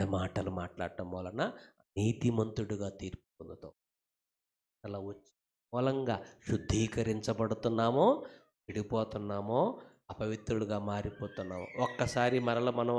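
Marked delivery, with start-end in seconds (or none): none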